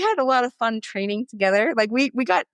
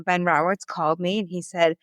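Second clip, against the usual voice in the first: normal talking voice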